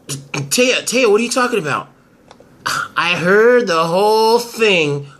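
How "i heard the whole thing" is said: ordinary talk, nothing marked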